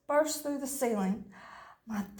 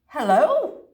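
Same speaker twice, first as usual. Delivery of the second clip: barked; 3 semitones higher, 7 dB louder than usual. A narrator's reading. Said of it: "she barked".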